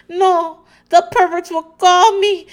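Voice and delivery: high, loud hammed-up wail; silly mock panic, breaking into laughter; bad accent imitation. Host redoing Mama Elsa's protest, mock-distraught. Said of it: "This is just me being idiot".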